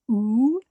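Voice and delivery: long oo for the U in Uruguay; U pronounced oo. Shown as a demonstration. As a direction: demonstrate the Spanish U as oo. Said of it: "So the U is pronounced".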